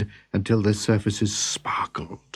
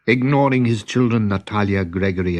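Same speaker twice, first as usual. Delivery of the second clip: ordinary delivery, nothing marked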